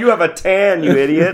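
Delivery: mock taunting. Imagined Nord making fun of a tanned foreigner, mock taunting. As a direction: hurl a silly insult in fun. Voicing Nords mocking them.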